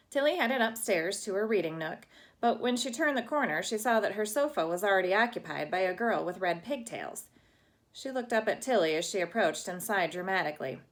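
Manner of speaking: plain reading voice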